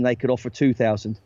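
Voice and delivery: ordinary talk, nothing marked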